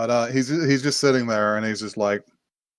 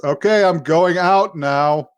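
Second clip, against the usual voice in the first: slow, higher-pitched staged announcement; loud announcement; 5 semitones higher, 4 dB louder, slower than usual. Acted. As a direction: announce it pointedly so the whole house hears. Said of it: "loudly just says".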